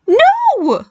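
very high, squeaky shriek. A narrator's reading. Barry refuses to let Dad be called, shrieking. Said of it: "Barry shrieked".

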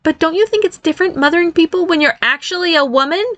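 high-pitched son turning ornery; growing ornery. A narrator's reading. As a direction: start reasoning, end testy. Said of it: "His voice grew ornery at the end".

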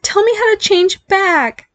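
high, drawn-out plea; pleading. A narrator's reading. Barry begs mother to change him back, pleading. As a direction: beg slowly in a squeaky voice. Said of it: "he pleaded".

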